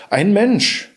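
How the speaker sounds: high, startled exclamation; surprised cry. Acted. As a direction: gasp it out in surprise. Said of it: "rief sie".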